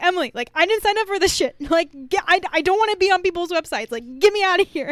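high, loud and fast, half laughing; frantic retold panic. Begging Emily to get her out, hammed-up panic. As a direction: rattle it off in mock desperation. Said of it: "I'm like".

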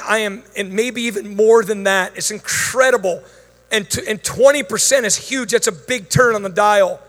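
usual speaking voice

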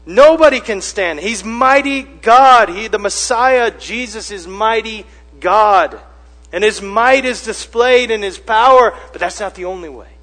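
high, loud, emphatic sermon delivery; full-force preaching. Real, not acted. Preacher proclaiming mighty God to the congregation, forceful. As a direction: drive the point home to the congregation.

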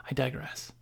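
soft unvoiced whisper; whispered aside. Real, not acted. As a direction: breathe it as an aside.

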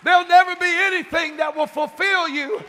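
loud, high, building preacher's cry; preaching at full force. Real, not acted. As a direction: climb to a shouting climax about God's presence.

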